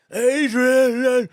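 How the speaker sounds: high, loud drawn-out movie-hero cry; Rocky. Acted. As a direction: bellow the name like the boxer calling out. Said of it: "it sounds like Rocky".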